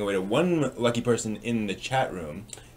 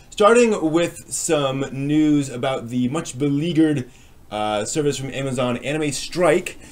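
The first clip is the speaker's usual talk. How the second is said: news read; 4 dB louder than usual. Acted. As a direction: announce the headlines like a host. Opens the weekly news segment.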